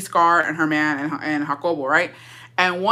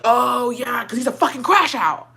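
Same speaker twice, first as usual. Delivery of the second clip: hammed-up realisation, pitched up; mock exasperated retort; 5 semitones higher than usual. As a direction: exclaim it with knowing exasperation. Acts out her exasperated retort.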